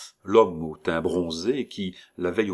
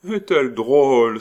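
much louder, higher mock delight; exclaiming; 6 semitones higher, 10 dB louder than usual. Old woman exclaims over the child, loud and high. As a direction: cry it out in fond delight. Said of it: "s'écria la vieille".

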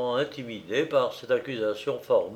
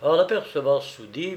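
ordinary delivery

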